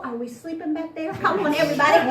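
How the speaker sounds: plain reading voice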